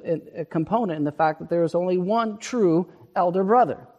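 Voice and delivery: impassioned sermon build; full-force preaching. Acted. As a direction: drive the point home to the congregation.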